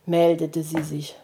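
plain reading voice

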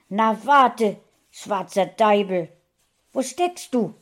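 lautes, drohendes Rufen; drohend gerufen. A narrator's reading. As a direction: der Katze drohen, laut. Frauchen droht der Katze. (Frauchen droht der Katze, laut)

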